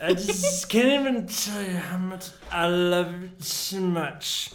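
put-on high, loud sappy drunk. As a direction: slur your love like a drunk. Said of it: "You make the best drunk voice".

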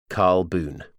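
ordinary talk, nothing marked

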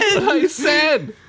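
high mock squawk, laughing; hammed-up high-pitched protest; exasperated Boimler echo. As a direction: echo the indignant squeal through a laugh. Co-host echoes Boimler's exasperated outburst.

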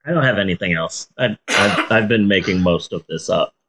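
lazy, stoned and rambling; stoner. Real, not acted. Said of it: "I'm pretty high right now".

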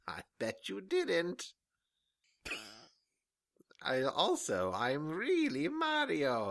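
slow, high mock villain; slow theatrical villain menace. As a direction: draw out each word smugly. Mario villain reveal continues.

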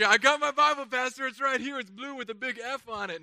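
lightly voiced eager church member; mock congregant. Church member showing the pastor a fake Bible, mock eager.

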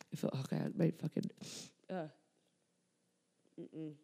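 gulping down phlegm while talking; swallowing between words; swallowing mid-sentence. Real, not acted. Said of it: "I'm, like, swallowing my own loogies right now".

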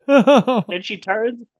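ordinary delivery, nothing marked

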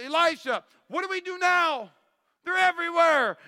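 high, loud, alarmed cry for help; a servant who just woke up surrounded, panicking; panicked servant. Retold or imitated. Servant wakes Elijah, enemy all around.

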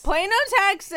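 loud, high excited repeat; excited echo. Real, not acted. Echoing with excitement.

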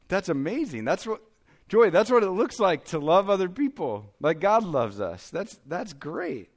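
high-pitched, swooping delight; amazed praise. Retold or imitated. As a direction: gush like a proud dad praising his daughter. Dad praising his daughter, retold.

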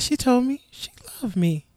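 soft whisper of disbelief; hushed stunned confiding. Retold or imitated. Telling niece what granny said, stunned whisper. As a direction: whisper it like unbelievable news.

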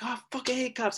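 high mock rant; imitated angry outburst. Friend punching a mailbox, high mock outburst. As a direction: blurt it out high like a hothead.

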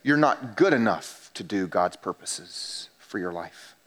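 low, slow, taunting whisper-like voice of the enemy; enemy's taunting voice. Acted. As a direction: sneer it like the tempter. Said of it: "he comes in with the next one to say".

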